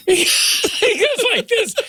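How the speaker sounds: words squeezed out through laughter; can barely get it out for laughing; high helpless laughter. Real, not acted. Laughing while retelling.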